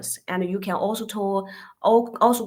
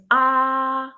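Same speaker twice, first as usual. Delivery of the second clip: AH held flat and straight on a steady note; first tone, flat; 3 semitones higher than usual. Shown as a demonstration. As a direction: hold AH long and level, like singing one note. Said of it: "the first tone is".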